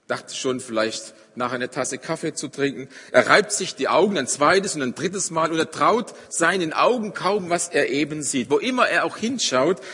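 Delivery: forceful preaching. Acted. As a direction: drive the Bible story home to the congregation. Preacher dramatises the scene vividly. (kräftige, lautere Predigtstimme)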